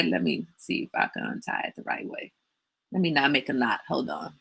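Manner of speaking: soft, half-whispered "let me see"; muttered aside. Real, not acted. Untying the hat on camera, murmured under breath. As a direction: mutter to yourself while fiddling with the knot.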